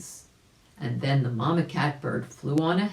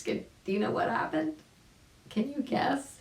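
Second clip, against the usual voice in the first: teasing question; 11 semitones higher, 3 dB quieter than usual. A narrator's reading. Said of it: "asking young listener".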